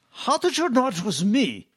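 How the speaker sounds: high-pitched imitation German accent; thick German accent. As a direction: mimic an astonished German colleague, thickly accented. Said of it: "in his very thick German accent".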